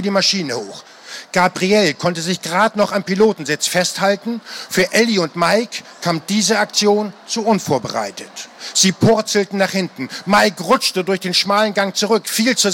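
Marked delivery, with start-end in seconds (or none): none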